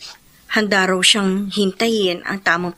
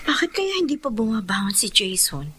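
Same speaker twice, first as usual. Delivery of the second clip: whispered to herself; 6 dB quieter than usual. Acted. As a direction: breathe it out so as not to wake him. Sister wonders aloud, whispering.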